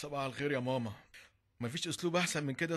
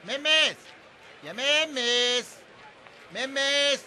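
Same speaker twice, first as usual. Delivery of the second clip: much higher, louder calls; calling out; 9 semitones higher, 6 dB louder, much slower than usual. Acted. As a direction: call her from across the house. Calling someone from afar. (نداء عالي)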